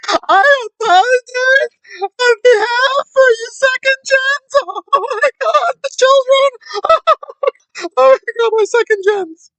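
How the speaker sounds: mock tearful wail, very high and loud; high-pitched, hammed-up blubbering apology; Ellie. Mocking a woman apologizing to second gens, in a very high wail. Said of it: "let me not exclude Ellie, who became peak smooth brain, who said".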